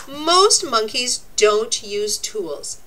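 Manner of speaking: ordinary talk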